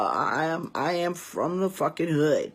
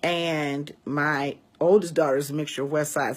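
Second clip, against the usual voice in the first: ordinary delivery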